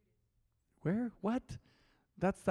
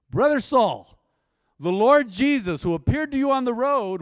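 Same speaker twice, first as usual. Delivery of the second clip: much higher and louder preaching at full force; 10 semitones higher, 11 dB louder than usual. Acted. Preacher voicing Ananias to the congregation, booming. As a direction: proclaim it to the whole church.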